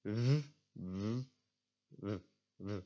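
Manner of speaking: a buzzed V cut progressively shorter; "v" held, then repeated in short bursts; shortened V sound. Shown as a demonstration. Teacher drilling a Dutch consonant, shortened V sound. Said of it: "try and make it shorter".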